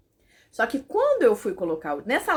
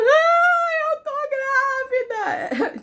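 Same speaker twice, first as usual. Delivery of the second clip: very high, slow tearful wail with a laugh; crying out; 5 dB louder, much slower than usual. Retold or imitated. Stepping outside to her ex-husband, crying out. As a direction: wail the news through tears. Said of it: "e comecei a chorar".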